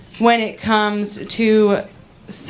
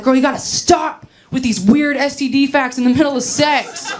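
higher, exasperated imitation of a scolding; mock mad boyfriend; 5 semitones higher than usual. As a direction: act out a fed-up lover's complaint. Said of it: "He gets so mad".

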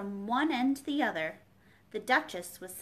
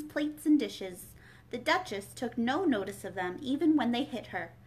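ordinary delivery, nothing marked

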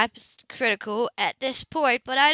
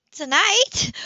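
surprised echo; 11 semitones higher, 5 dB louder than usual. Acted. Surprised echo of his words.

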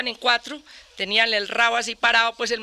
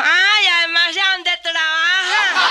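higher, louder and slow, savouring the catch; gleeful discovery; 5 semitones higher, 5 dB louder, much slower than usual. Retold or imitated. Boy recognising the priest through the curtain, gleefully slow. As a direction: drag it out like a kid who's hit the jackpot.

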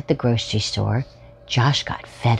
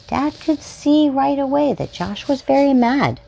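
usual speaking voice

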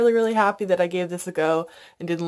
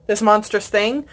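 usual speaking voice

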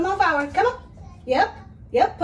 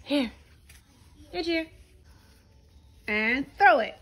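ordinary talk, nothing marked

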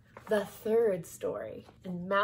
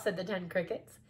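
ordinary delivery, nothing marked

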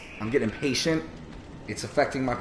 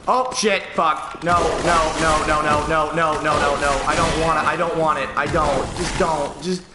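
high, loud desperate no's; rising panic, louder and higher; 1 panicked pleading; 5 semitones higher, 4 dB louder than usual. Real, not acted. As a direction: beg the game not to let it happen. About to die, panicking.

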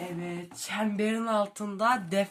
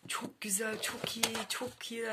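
whisper; 3 semitones higher, 6 dB quieter than usual. Real, not acted. Admiring the binding.

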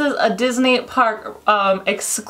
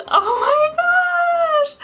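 very high, slow, crying squeal; overwhelmed teary gush; tearful squeal; much slower than usual. Real, not acted. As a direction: squeal as if your heart burst. Overwhelmed, near tears.

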